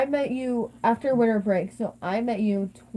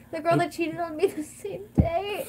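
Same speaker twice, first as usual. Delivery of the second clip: laughing; 8 semitones higher, slower than usual. Real, not acted. Laughing while telling cheating story.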